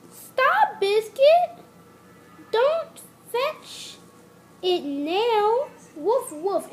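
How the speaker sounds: very high, loud call to stop; girl shouting stop. Acted. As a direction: yell after the puppy.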